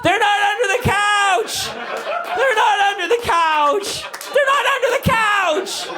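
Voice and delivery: very high, mock kid shriek; hammed-up high-pitched child pleading; frantic child. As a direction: wail it like a desperate little girl. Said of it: "she hurls her little forty-five pound body".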